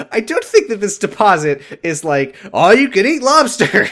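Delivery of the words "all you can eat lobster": high, loud mock restaurant announcement; mock promo shout. Doubting the deposit covers everything, mock promo shout. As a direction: belt it out like an over-the-top deal.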